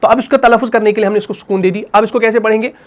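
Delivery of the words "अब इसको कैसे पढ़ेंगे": ordinary talk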